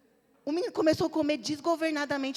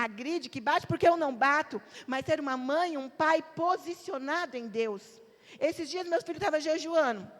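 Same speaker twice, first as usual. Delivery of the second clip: ordinary delivery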